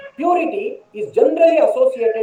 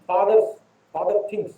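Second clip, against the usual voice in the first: normal talking voice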